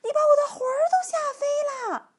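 high, loud, fast panicked squeal; scared-out-of-her-wits old lady; frightened cry. A narrator's reading. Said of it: "那个瘦小的老妇人喊起来".

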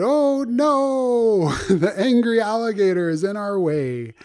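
high, wailing storybook alarm; hammed-up high "oh no" wail; mock dismay. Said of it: "And they said".

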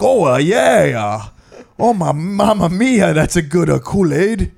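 high hammed-up Italian accent, as the Kool-Aid Man; a jokey Italian Kool-Aid Man saying sorry; mock Italian accent. Joking to a friend about the Kool-Aid Man, in a high mock Italian accent. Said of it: "he was Italian to be like".

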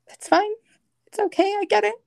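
high, strained brave voice; pushing through tears to say it's fine; forced fine. Retold or imitated. To her husband in the NICU, a high strained brave reply.

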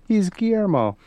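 high-pitched quoted exclamation; imitating friend's remark. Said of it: "she was like".

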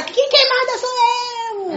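high, slow put-on father voice, stretched out; mock stern father. Father answering his shouting son, in a high, slow mock voice. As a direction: play the father firing back at the shouting son. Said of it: "o pai fala".

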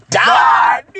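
loud burst; shouted. Real, not acted. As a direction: shout the word out. Laughing outburst.

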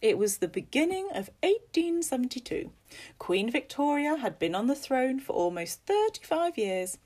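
high, emphatic, lilting storyteller; animated ghost-story telling with big pitch swoops; storyteller Missus Fox. Acted. Missus Fox telling guests about a ghost, animated storyteller.

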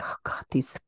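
whispered mock complaint; exasperated whisper. Host voicing annoyed listeners to friends, whispered complaint.

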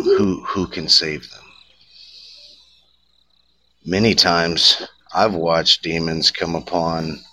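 normal talking voice

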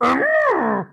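elephant trumpeting; high nasal trunk call; mammoth voice. Pretend. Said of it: "I'm gonna try anyway".

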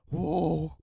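loud, high mock "whoa"; mock exclamation. Comedian acts out kids reacting in the story, exclaiming. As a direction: cry out in exaggerated astonishment.

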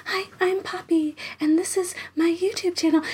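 high, soft, creepy mock whisper-talk; put-on airy voice, pitched well above normal. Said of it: "like, really creepy".

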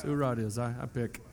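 normal talking voice

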